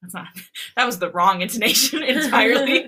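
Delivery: real giggling fit while talking; laughing. Real, not acted. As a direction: laugh through the self-correction. Cracks up at own misreading.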